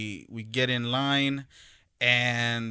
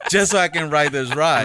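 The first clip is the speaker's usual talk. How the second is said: laughing through it, higher and louder; 6 semitones higher, 7 dB louder than usual. Real, not acted. Dad laughing about breaking a rib to ride. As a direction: chuckle through the words.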